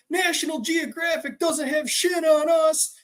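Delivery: high, drawn-out mock shout; worked-up. Shaken buddy bragging after bear chase, worked up. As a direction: imitate a hyped-up friend hollering. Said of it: "He's so worked up".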